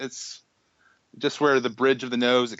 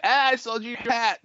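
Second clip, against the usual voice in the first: hat guy; 13 semitones higher than usual. Pretend.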